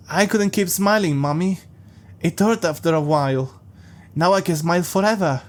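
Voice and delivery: put-on a small child proudly telling his mommy; childish creepy voice. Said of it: "it's like he reverted back to being a five-year-old".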